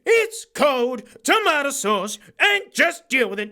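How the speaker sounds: high-pitched, over-the-top snack hype; hammed-up excitement. Vlogger showing mates sausage rolls, hammed-up excitement. Hyping supermarket snacks at the start.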